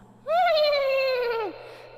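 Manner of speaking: high, loud neighing laugh; eerie laugh. Acted. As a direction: let out a creepy horse laugh. Acting horse laugh in story.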